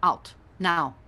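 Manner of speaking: sharp command. Acted. Yegane ordering earrings out.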